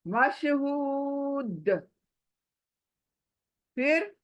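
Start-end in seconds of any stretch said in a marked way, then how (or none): 0.1-1.7 मशहूद slow with a bounced दाल at the end; qalqala stop. Shown as a demonstration. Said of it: "दाल को हिलाना".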